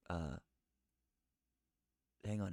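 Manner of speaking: ordinary talk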